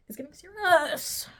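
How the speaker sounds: hammed-up seriousness; mock dramatic. Real, not acted. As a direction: announce it gravely for laughs.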